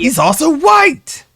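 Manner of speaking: mock outrage. Mock outrage about the verdict.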